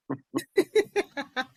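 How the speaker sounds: real high-pitched chuckle; light run of laughter after a wry remark. Real, not acted. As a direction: chuckle at your own joke. Guest jokes about unanswered calls.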